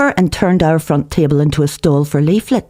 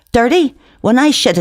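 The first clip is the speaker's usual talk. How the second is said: indignant retort; 10 semitones higher, 3 dB louder than usual. A narrator's reading.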